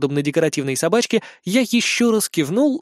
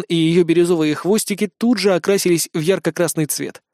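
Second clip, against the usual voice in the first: ordinary delivery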